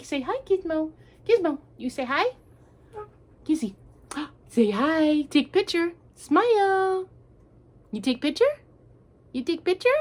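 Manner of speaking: high coaxing voice for the pet; sweet high pet talk. Real, not acted. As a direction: coo at the dog to say hi. Cooing to her dog.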